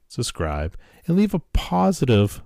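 ordinary talk

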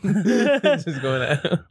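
ordinary talk, nothing marked

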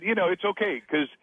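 normal talking voice

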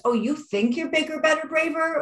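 mocking taunt. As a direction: taunt it like a sceptic. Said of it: "the universe throws it in your face".

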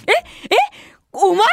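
high, cracking shout of disbelief; shocked disbelief. Retold or imitated. Narrator reacts to the girl joining in, stunned and shouting. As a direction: yelp out a stunned double take.

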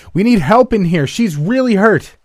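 loud, very high-pitched yell; screamed cry for help. A narrator's reading. Tatum calls doctors for hurt partner, screaming. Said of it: "screamed at the top of their lungs".

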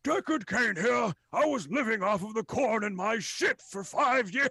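put-on high, loud cranky old prisoner; grumbling old man, voice pushed up high; Deckard Cain. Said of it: "it's like, it's like".

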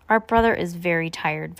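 ordinary delivery, nothing marked

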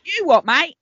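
high, broad Essex "You what, mate?"; Essex accent. Shown as a demonstration. Host jokes her native Essex accent slips out, pitched high. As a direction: slip into a cheeky Essex accent. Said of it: "one's native accent is slipping out there".

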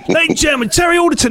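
mock MC announcement. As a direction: announce the act like a showman. [name] announcing the act.

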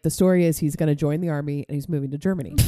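ordinary talk, nothing marked

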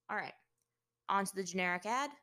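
ordinary delivery

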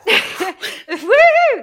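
high, loud laughing "woo"; big high whoop through a laugh; excited whoop. Real, not acted. Playful whoop starting the countdown.

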